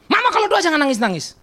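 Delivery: high-pitched protest at mom; annoyed complaint. Retold or imitated. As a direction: re-enact a son's exasperated complaint. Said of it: "saya jengkel kalau dia nangis". (menirukan anak jengkel, nada tinggi)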